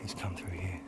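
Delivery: hushed whisper. Real, not acted. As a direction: whisper it under your breath. Tracking the man, whispers.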